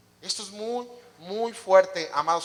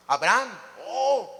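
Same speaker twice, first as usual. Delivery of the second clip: mock awe; 2 semitones higher, slower than usual. Mock reverence for a Bible hero.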